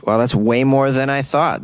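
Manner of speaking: ordinary delivery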